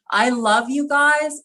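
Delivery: pushing the words out through tears; emotional. Real, not acted. Host telling castmates she loves them, moved. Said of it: "I'm getting emotional".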